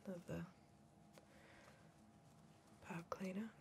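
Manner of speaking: soft unvoiced whisper, close and intimate; hushed soothing whisper; ASMR whisper. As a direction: breathe it like an ASMR video. ASMR-style whispering to victim.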